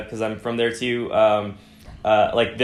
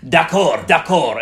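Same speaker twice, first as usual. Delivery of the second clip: French waiter; 5 semitones higher, 4 dB louder, slower than usual. Retold or imitated. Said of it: "he was definitely playing it up a lot".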